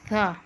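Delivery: "सा" said with the tongue out between the teeth; Arabic thaa. Shown as a demonstration. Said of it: "तो इसलिए इसको इस तरह से पढ़ते हैं". ("सा" ज़ुबान बाहर निकाल कर)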